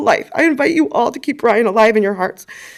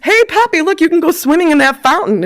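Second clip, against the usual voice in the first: teasing; 6 semitones higher than usual. Retold or imitated. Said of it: "Brian says to my grandfather".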